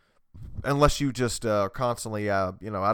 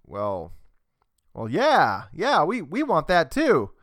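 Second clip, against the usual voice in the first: halting start, then drawn-out higher agreement; mock Republican; 4 semitones higher, 4 dB louder, slower than usual. Podcaster mocking Republicans agreeing with libertarians, hesitant then higher and drawn out. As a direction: play a hesitant yes-man, stretching it out. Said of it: "the Republicans are like".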